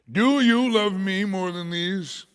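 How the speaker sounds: loud, slow, drawn-out challenge; emphatic solemn question. Acted. The Lord questions Simon, loud and slow. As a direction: put the question slowly and forcefully.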